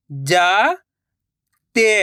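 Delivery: जाते drawn out slowly, syllable by syllable; slow syllable split. Shown as a demonstration. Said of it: "मैं किस तरीके से".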